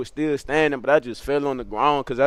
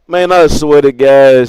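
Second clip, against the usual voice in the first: much louder, slow, laughing through the words; 12 dB louder, slower than usual. Real, not acted. Narrator recovering from laughter, loud and slow. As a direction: shout it out while still laughing.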